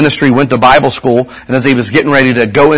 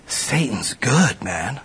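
hushed warning; 12 dB quieter, much slower than usual. Acted. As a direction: drop to a hushed slow warning.

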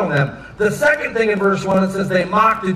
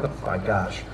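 quiet aside; 11 semitones lower, 9 dB quieter than usual. Acted. God's unimpressed aside over chess.

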